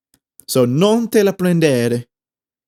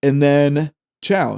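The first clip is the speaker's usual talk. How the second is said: ordinary talk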